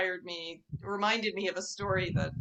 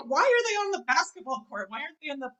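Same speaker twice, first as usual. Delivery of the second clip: exasperated complaint; 7 semitones higher, 5 dB louder, much faster than usual. Retold or imitated. Her annoyed reaction at the courts.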